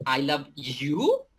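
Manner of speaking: "you" stretched out slowly with a rising tune; questioning stress on you. Shown as a demonstration. Teacher showing the third intonation, questioning rise on "you". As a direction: ask it on the last word, lengthening "you". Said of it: "Do I love you?".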